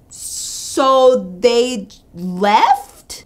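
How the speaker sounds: very slow, incredulous question; stunned disbelief. Retold or imitated. Friend learning the boys ditched their girls, stunned disbelief. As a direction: drag each word out in disbelief. Stunned reaction to retold news.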